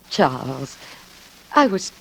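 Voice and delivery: measured narrator voice over music; reflective narration. A narrator's reading. First-person narration over music.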